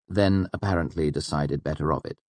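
ordinary delivery

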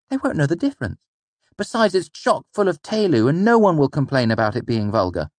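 put-on a keen young boy suggesting. A narrator's reading. Boy's voice held.

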